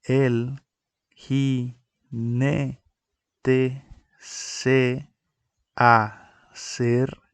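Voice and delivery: slow, each syllable set apart with pauses; counting the syllables of "jinete" and "acercaba" aloud; split into syllables. Shown as a demonstration. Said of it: "que quedarían de la siguiente manera".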